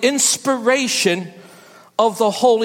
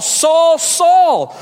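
calling out; 4 semitones higher, 5 dB louder than usual. Acted. Jesus calling out to Saul.

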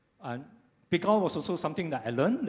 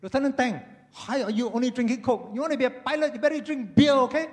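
high, loud voice of a pushy boss; bossy commander; 10 semitones higher, 6 dB louder, faster than usual. Retold or imitated. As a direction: play the boss pressing a junior officer.

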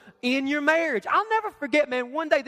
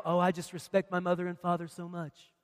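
softer, lower fake-sweet teen; lightly mocking saintly voice; mock pious; 8 semitones lower, 7 dB quieter than usual. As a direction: sneer it as a goody-two-shoes. Teen shining their halo, mocked.

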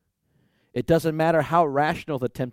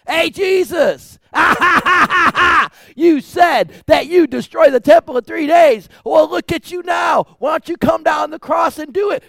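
loud, high jeering mocker; hurling insults; 14 semitones higher, 12 dB louder than usual. Crowd jeering at Jesus on the cross, loud mocking shouts. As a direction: yell taunts up at him, sneering. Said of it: "hurled insults at him".

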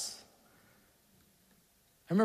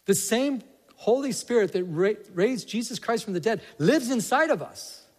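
higher, louder preaching with rising fervor; impassioned preaching; 6 semitones higher, 3 dB louder than usual. Acted. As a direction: preach it with full conviction. Preaching builds to full force.

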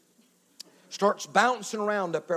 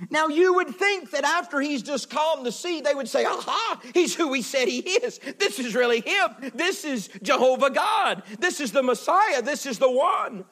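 higher-pitched, driving sermon delivery; impassioned preaching; 7 semitones higher, 4 dB louder than usual. Real, not acted. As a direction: preach it with full, rolling energy. Preaches at full force.

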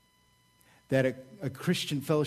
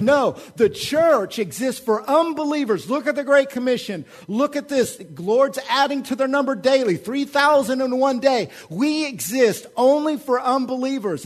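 high, loud, insistent argument; fervent advocates; 8 semitones higher, 6 dB louder than usual. Acted. One group over here making its case, loud and fervent. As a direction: voice a zealous group, pitched up and emphatic. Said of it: "So one group over here says".

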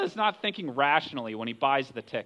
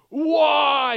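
loud, raised-pitch acted yell; 7 semitones higher, 6 dB louder than usual. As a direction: yell it like a desperate sailor. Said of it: "They're probably yelling at him".

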